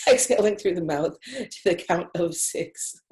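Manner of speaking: reading through a real laugh; laughing while reading. Real, not acted. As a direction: giggle through the instruction. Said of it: "I don't know why I put laugh there".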